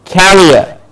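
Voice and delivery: carrier as "KA-ree-er", stressed first; careful pronunciation. Shown as a demonstration. Teacher contrasting it with career, careful "KA-ree-er". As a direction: hit the front of carrier. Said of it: "how I am pronouncing each word".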